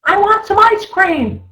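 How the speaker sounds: put-on high, whiny sulky kid; little child voice. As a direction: whine it like a sulking little child. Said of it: "the little child that says".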